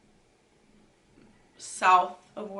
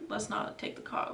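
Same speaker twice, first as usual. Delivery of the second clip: whispered plea; 9 dB quieter than usual. Retold or imitated. Hushed plea to friend.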